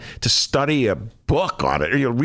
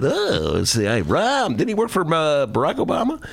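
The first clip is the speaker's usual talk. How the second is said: high, puzzled mock voter; befuddled voter; 7 semitones higher than usual. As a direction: scratch your head, pitched up. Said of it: "Kinda, like, befuddled".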